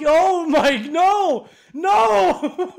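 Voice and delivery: high, loud panicked no; shouting no. Real, not acted. Player yelling at the game going wrong, high and loud. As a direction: yell it as it all falls apart.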